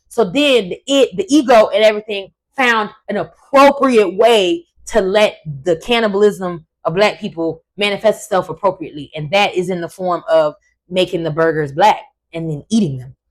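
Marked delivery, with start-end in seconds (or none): none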